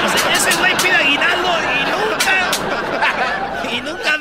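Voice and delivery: hablando entre risas; riéndose mientras lo cuenta. Real, not acted. Se ríe contando lo del güey. (riéndose a carcajadas)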